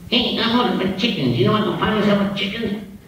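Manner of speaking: high, kiddish little hawk voice; little chicken hawk. Acted. Little hawk asking a chicken, in a high voice. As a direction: pipe up like a tiny eager hawk. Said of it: "this little hawk came up to this chicken and said".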